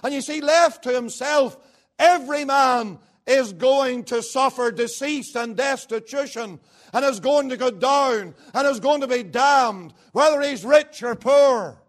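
loud, rising preacher's fervour; full-force preaching. Acted. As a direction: thunder the verdict on every man.

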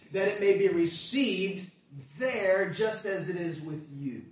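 loud, emphatic sermon delivery; forceful preaching. Real, not acted. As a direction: drive each point home to the congregation. Preaches a scripture passage forcefully.

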